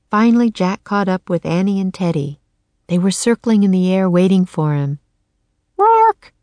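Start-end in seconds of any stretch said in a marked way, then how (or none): 5.8-6.3 very high, loud squawk; raven croak. A narrator's reading. Jack joining the others in flight, loud high croak. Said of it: "Jack croaked".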